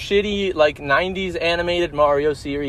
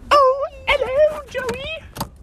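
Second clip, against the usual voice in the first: mock high greeting; much slower than usual.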